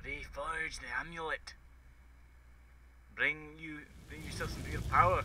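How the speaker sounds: attempted Scottish accent slipping into drunken Irish; botched Scottish accent. Gamer voicing a quest line, Scottish accent gone drunken Irish. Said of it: "my Scottish accent turned into a ... drunken Irish accent".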